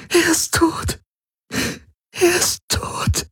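very high, breaking, fading to a sob; distraught sobbing. A narrator's reading. Ylana grieving for the dead man, voice breaking. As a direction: let the second one collapse, slow and breathy.

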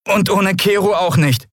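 put-on high boy's voice, a touch unsure. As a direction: add it with waning conviction. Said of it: "mit etwas weniger Überzeugung".

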